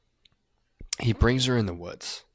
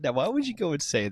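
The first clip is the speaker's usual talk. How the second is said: incredulous; 6 semitones higher, much faster than usual. Real, not acted. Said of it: "That's what he says".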